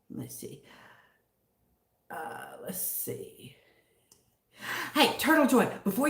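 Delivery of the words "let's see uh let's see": very soft, whispery murmur; slow, barely voiced pondering; whispered thinking aloud. Real, not acted. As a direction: whisper to yourself while searching. Reading chat to herself.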